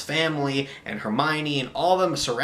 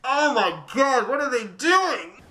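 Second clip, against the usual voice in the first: exasperated disbelief; 8 semitones higher, slower than usual. Real, not acted. Exasperated at the movie ending.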